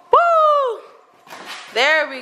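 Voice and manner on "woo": excited whoop. Real, not acted. Cheering the dog on.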